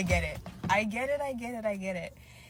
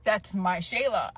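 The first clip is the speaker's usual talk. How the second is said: ordinary delivery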